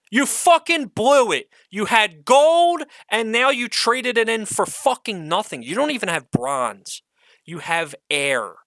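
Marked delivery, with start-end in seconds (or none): 0.1-5.3 higher and louder, slowing down on gold; angry shout. Real, not acted. Telling the studio they threw away gold, angry shout. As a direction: scold them at full voice.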